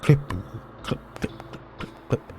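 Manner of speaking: whispered clip clip of heels; heel clicks. Shown as a demonstration. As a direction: tap out the footsteps in a hushed voice. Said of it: "these heels clipping".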